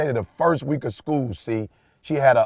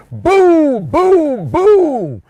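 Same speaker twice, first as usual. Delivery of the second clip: much higher and louder triumphant boom boom boom; celebrating; 9 dB louder, much slower than usual. Retold or imitated. Proud dad celebrating his daughter's win, triumphant shout. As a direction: belt it out like a victory chant.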